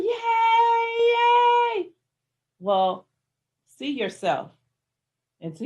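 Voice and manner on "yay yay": high, loud crowd cheer at the finish line. Retold or imitated. Crowd cheering a runner at the finish line, loud and high. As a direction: cheer like the whole crowd watching. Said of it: "everybody's going".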